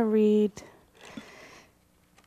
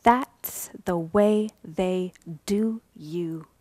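ordinary talk, nothing marked

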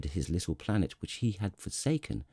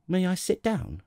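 high, tentative little-prince voice; timid inquiry; 10 semitones higher than usual. A narrator's reading. Little prince asks the king for a seat, timidly. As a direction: ask shyly, pitched up high. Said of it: "came now a timid inquiry".

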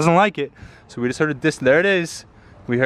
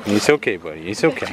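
low, gentle comforting murmur; soothing reassurance; 7 semitones lower than usual. Real, not acted. Owner reassuring the held dog, low and soothing.

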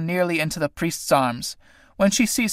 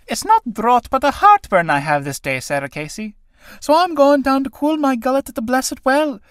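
feigned pacifying; 8 semitones higher than usual. Acted. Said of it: "in a feigned tone of pacification".

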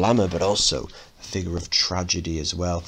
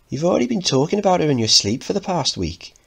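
teasing mother; 10 semitones higher, 3 dB louder, faster than usual. Acted. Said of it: "laughing at his transparency".

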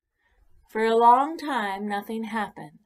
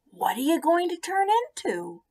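eager pollywog; 9 semitones higher, 3 dB louder than usual. Acted.

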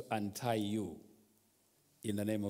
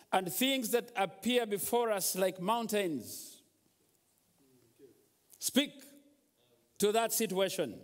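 exhorting preacher voice, raised; preacher rousing the congregation; preaching; 8 semitones higher than usual. Acted. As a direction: urge the church to speak up.

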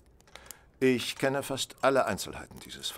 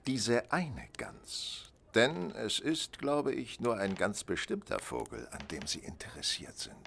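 usual speaking voice

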